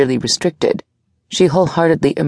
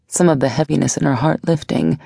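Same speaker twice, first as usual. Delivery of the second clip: plain reading voice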